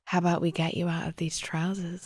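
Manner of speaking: soft seductive whisper; sexy whisper. Retold or imitated. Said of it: "whispers in his ear in a really sexy voice".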